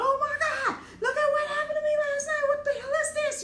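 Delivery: very high, loud, fast panic with a laughing cry; panicked plea. Retold or imitated. Showing the marks to the ladies while wrapped in a towel, high panicked plea. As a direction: blurt it out half laughing, half crying in panic. Panicked call to her friends.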